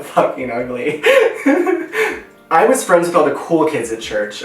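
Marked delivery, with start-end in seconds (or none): 1.1-2.2 bright real laugh before the story. Real, not acted. Vlogger laughs to viewers before starting story, real laugh.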